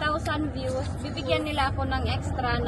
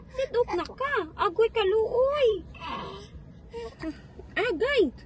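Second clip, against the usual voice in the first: put-on baby talk; 8 semitones higher than usual. Cooing to crying baby.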